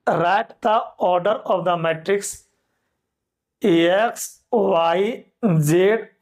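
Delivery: very slow, word by word dictation. Shown as a demonstration. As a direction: dictate slowly for note-taking. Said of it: "अगला question". (धीरे-धीरे लिखवाना)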